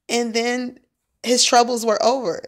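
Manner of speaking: ordinary talk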